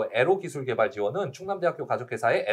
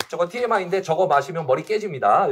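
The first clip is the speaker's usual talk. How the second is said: ordinary talk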